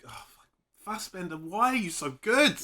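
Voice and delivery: high, rising outburst of awe; exasperated admiration. Acted. Fan watching the film at an actor, exasperated admiration. Acting out awed viewer reaction.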